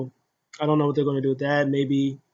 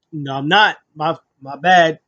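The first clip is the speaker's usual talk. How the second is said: mock defensive protest; 8 dB louder than usual. Acting the accused man's retreat.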